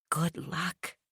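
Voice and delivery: barely voiced, very soft; mouthed. A narrator's reading. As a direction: mouth it almost silently. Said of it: "Becky mouthed".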